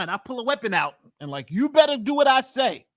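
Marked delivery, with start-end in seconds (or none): 1.5-2.9 raised, higher commanding voice; acted threat. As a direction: order them as if holding a weapon. Acting out an armed command.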